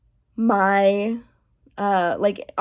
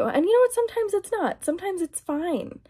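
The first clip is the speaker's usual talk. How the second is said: much higher, sing-song concession; high mock concession; 10 semitones higher than usual. Vlogger conceding to viewers, high and sing-song. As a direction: pitch it way up, playfully conceding.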